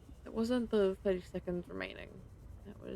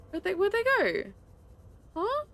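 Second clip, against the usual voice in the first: confused shout; 13 semitones higher, 7 dB louder than usual. Real, not acted. Coral vanished, confused.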